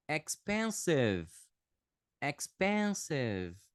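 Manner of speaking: "Expensive" drawn out, stressed on PEN; ex-PEN-siv, stretched for learners; slow model pronunciation. Shown as a demonstration. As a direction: slowly say "Expensive" with a short unstressed last syllable. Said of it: "vou falar em voz alta aqui esses adjetivos".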